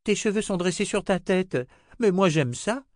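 put-on high-pitched little girl voice; little girl Rachel. Little girl urges the old wizard to eat, high child voice.

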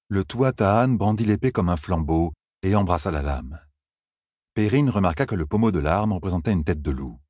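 ordinary delivery, nothing marked

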